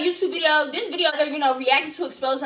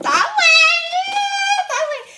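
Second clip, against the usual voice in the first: high laughing squeal; can't hold it together; 13 semitones higher than usual. Real, not acted. Squealing through laughter at the clip.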